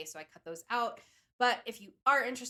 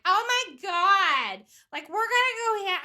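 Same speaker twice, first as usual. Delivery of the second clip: imitating a loud woman, much higher and louder; loud tourist; 11 semitones higher, 13 dB louder than usual. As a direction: mimic a brash tourist shouting. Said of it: "super loud".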